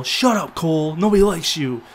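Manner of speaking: mock yelling. Wrestler heckling Cole the commentator during a match, mock yelling. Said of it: "he was, like, yelling at Cole".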